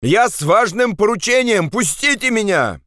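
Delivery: high-pitched pleading shout; urgent protest. Acted. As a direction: call out high to stop them. Andres protests against grenade threat. (отчаянный крик протеста)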